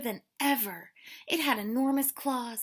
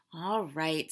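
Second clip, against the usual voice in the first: calm; 6 semitones lower than usual. Acted. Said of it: "said Hedgehog calmly".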